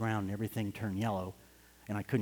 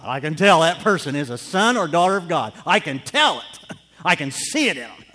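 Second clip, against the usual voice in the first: louder, higher, fervent; impassioned preaching; 4 semitones higher, 11 dB louder than usual. Real, not acted. Preacher proclaiming to the church, fervent. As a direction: declare it with conviction.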